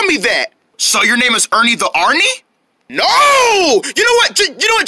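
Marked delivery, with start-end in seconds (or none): 2.9-4.9 high-pitched, fed-up protest; exasperated. Acted. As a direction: burst out high at being misunderstood. Fed up at being misunderstood.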